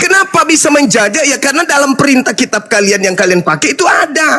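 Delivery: high-pitched, forceful debating; indignant argument in a high pitch; heated accusation. Real, not acted. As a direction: press the charge hard, voice pitched right up.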